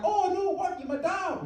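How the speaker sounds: high, soft Geordie disbelief. Acted. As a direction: play a stunned son with a high Geordie voice. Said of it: "I don't know why I made him Geordie".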